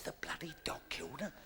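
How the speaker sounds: hushed, stunned question; aghast whispered question; shocked whisper. Retold or imitated. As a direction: whisper it in disbelief. Shocked whisper at dog story.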